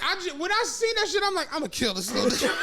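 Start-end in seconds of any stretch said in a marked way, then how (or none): 1.5-2.5 mock murderous laugh. Retold anger, laughing.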